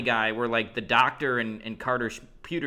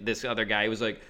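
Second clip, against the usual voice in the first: usual speaking voice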